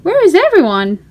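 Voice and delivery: put-on lively storybook reading for a small child. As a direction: read it like a bedtime picture book, bright and inviting. Reading picture book to child.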